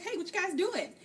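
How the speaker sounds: high-pitched casual greeting, retold; breezy interruption. Tavin walks up on the secret reading lesson, breezy mock greeting. Acting out Tavin walking up on them.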